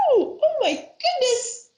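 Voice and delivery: high, slow laughing gasp of surprise; mock surprise. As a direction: act amazed for the kids. Surprised face card.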